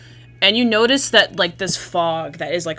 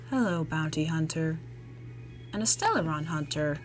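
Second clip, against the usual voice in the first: quiet, flat, eerie woman's voice; monotone eerie voice; 8 dB quieter than usual. Acted. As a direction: speak flat and soft, like a ghost. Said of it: "kind of monotone, like, eerie voice".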